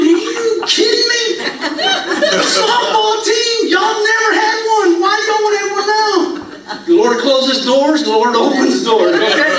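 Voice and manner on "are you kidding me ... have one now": high, laughing disbelief; retelling his stunned reaction with a laugh; incredulous. Retold or imitated. Incredulous retold outburst, laughing.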